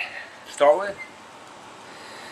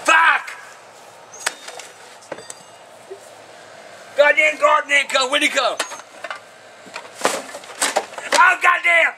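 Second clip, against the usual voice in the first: raging at the spilled garbage; furious shouting; 8 semitones higher than usual. Real, not acted. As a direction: shout it out in a rage. Garbage bag bursts, he rages.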